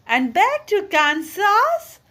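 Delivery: Dorothy jumping at hope of home; eagerly. Acted. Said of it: "she asked eagerly".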